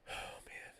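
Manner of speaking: whispered, overcome by the fight; barely whispering, moved, then quickening; emotional whisper. Real, not acted. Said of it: "I get a little emotional here".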